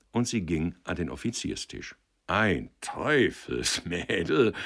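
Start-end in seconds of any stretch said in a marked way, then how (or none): 2.3-4.5 laughing. A narrator's reading. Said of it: "lachte Stickler".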